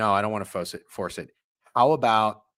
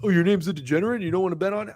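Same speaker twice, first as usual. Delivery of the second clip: mocking heckler voice; 7 semitones higher, much faster than usual. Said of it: "somebody's gonna say that".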